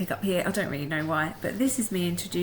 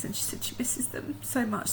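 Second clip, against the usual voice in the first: soft whisper; 6 semitones higher, 3 dB quieter than usual. Pretend. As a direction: whisper it like a secret. Voicing the dog's message.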